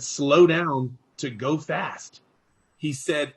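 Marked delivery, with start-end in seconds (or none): 0.0-2.2 slow, deliberate, each word spaced; calm mentor slowing a fast talker down; slow mentor advice. Retold or imitated. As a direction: spell the advice out slowly.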